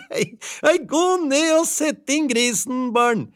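put-on high, laughing order to the kids; wife sending the children for the pig; laughing call. A narrator's reading. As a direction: call out through giggles.

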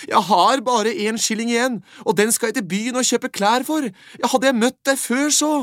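put-on boy giving up his last shilling, high and loud. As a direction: lament brightly like a child with one coin left.

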